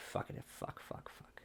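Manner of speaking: under-the-breath whispered curses; frustrated whispered swearing; whispered cursing. Real, not acted. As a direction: hiss the swears to yourself. Muttered curses to himself.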